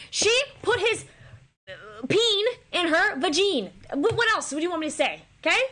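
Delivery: high, loud and flustered, begging to drop it; squirming through an awkward line; flustered exasperation. Real, not acted. Embarrassed before the class, high and loud, pleading to move on.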